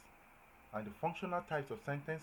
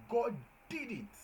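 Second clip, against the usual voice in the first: high, slow, joyful proclaim; higher and slower joyful exclamation; exclamatory; 11 semitones higher, 4 dB louder, slower than usual. Shown as a demonstration. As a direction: announce it like good news. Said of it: "that's an, uh, exclamatory".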